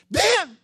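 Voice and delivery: "Then" cried out high and loud; shouted "Then". Acted. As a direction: hit "Then" like a trumpet blast. Emphatic sermon turning point.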